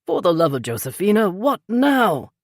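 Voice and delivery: alarmed, demanding what now; startled demand. A narrator's reading. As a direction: snap after a fright. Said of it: "Peter demanded".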